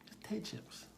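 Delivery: soft, high, airy; pained, breathy understatement; hushed lament. Real, not acted. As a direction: let it out almost as a whisper. Lamenting what frightened the boy.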